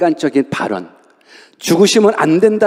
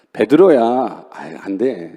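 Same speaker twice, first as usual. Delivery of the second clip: gentle scolding; 8 semitones lower, slower than usual. Retold or imitated. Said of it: "이 정도가 아니더라고요".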